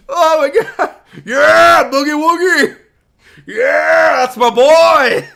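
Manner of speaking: high, loud screaming cheers; ecstatic cheering. Real, not acted. Streamer cheers on the dancing player, screaming with joy. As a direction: scream like a proud fan.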